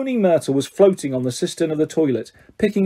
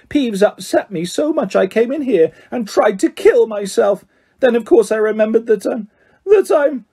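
high, wailing self-pity, building to a falter; milk the tragedy, then falter; dramatic; 6 semitones higher than usual. A narrator's reading. Myrtle recounting her upset, dramatically. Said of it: "said Myrtle dramatically".

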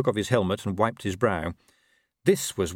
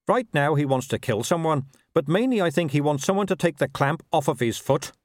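higher-pitched constable voice; reporting constable; 7 semitones higher than usual. Acted. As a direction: report upward to the captain in a raised pitch.

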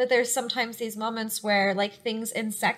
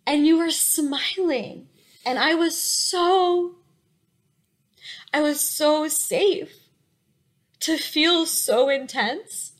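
higher and slow, voice welling up as she remembers; choked up with emotion; 5 semitones higher, slower than usual. Real, not acted. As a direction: let the feeling catch in the throat, slowing down. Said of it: "it makes me really emotional".

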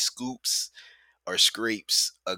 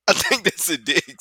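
higher, louder puzzled guess; mock realisation; 8 semitones higher, 7 dB louder than usual. Viewer retelling what they made out on screen, mock surprise. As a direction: voice lifting in playful astonishment.

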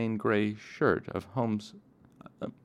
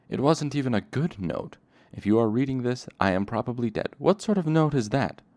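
indignant; 6 semitones higher, 5 dB louder than usual. Acted. Bast berates Coat over note.

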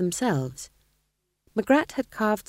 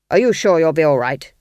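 worried king; 9 dB louder than usual. A narrator's reading. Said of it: "king worries for Nanny".

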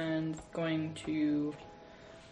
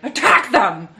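big shouted order from above; shouted command; 6 semitones higher, 18 dB louder, slower than usual. Acted. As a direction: yell it at full force. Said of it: "yell down and be like".

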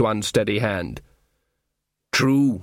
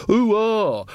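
a broad West Country yokel "Ooh, ah", pitched up; mock yokel; 7 semitones higher than usual. Rustic mocking city folk's view of them, in a lightly hammed yokel accent. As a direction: send up how city folk think rustics talk, West Country style. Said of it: "They all think we say".

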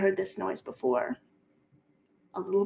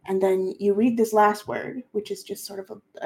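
usual speaking voice

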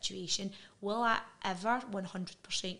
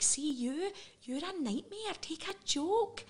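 mock exasperation; 8 semitones higher, slower than usual. Partner mocking her as oversensitive.

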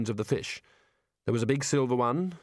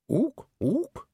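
higher puzzled ook ook; mock ape hoot; 12 semitones higher than usual. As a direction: copy the ape's hoots, unsure. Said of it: "Ridcully copying the ape".

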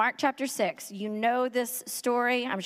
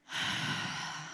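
exasperated sigh; 8 dB quieter than usual. Retold or imitated. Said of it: "if Jesus was a mean guy, he'd be like".

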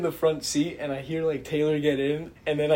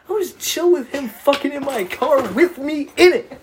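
normal talking voice

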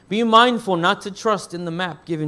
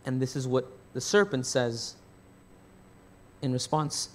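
ordinary talk, nothing marked

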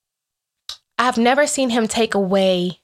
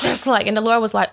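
normal talking voice

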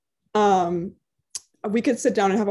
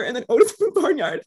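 normal talking voice

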